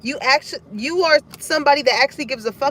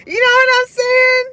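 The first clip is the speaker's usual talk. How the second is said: high, loud and tickled; real giddy, high-pitched exclamation; amused outburst; 12 semitones higher, 7 dB louder than usual. Real, not acted. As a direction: shout it high, fresh out of a laugh. Still amused after laughing hard.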